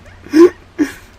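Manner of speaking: high, loud burst of laughter. Real, not acted. Cracking up at his own traffic rant, loud laugh. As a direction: crack up helplessly after ranting.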